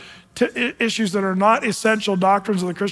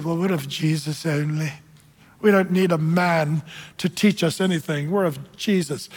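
lightly voiced pious believer, a little lowered; mildly put-on holier-than-thou voice; holy people; 3 semitones lower than usual. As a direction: imitate the super-spiritual faction. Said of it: "The holy people said".

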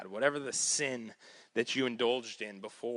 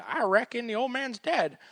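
put-on drawling accent; 12 semitones higher, 4 dB louder than usual. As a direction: play up a country "I reckon" accent. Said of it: "I just get that accent".

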